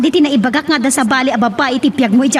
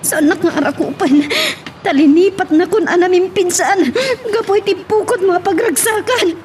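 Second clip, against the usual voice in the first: high, crying reproach; tearful pleading; 8 semitones higher than usual. Acted. Betrayed wife crying at her husband, high and tearful. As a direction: sob out the betrayal.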